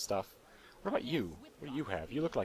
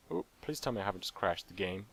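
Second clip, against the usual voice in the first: ordinary talk, nothing marked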